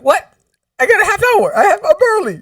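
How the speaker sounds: high, loud shocked outburst; loud comic protest at being rushed; panicked disbelief. Retold or imitated. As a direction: blurt it out in mock panic, pitch shooting up. Retold panic at being called in.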